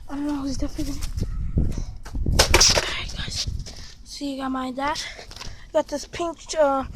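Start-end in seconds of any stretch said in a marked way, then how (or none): 1.6-3.5 whispering. Real, not acted. Hiding, whispering to camera.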